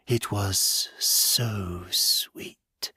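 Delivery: slow whisper, savoring each word. Acted. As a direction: breathe it out like a secret delight. Creature savoring memory.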